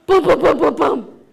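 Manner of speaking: rapid loud booms; imitated knocking. Beaver at Frog Woman's house, booming knocks. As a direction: pound out the knocks with your voice.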